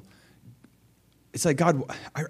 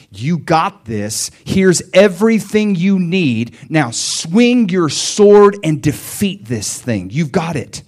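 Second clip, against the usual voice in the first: slow, louder rallying call; rally him to swing the sword; rousing fatherly charge; 5 semitones higher, 7 dB louder, slower than usual. Retold or imitated. God as a father rousing his son to fight, slow and louder.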